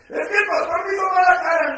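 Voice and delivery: high, loud, fast whiny churchgoer; hammed-up griping member, pitched high; mock complaint. Feligrés quejándose del pastor. (feligrés quejoso imitado, agudo, fuerte y rápido)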